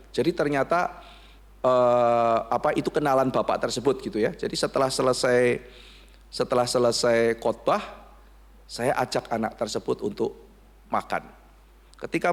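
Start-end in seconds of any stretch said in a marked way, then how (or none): none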